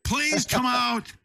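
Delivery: high, slow loudspeaker call; megaphone call. Retold or imitated. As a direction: echo the loudspeaker announcement. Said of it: "Now I got on a big speaker".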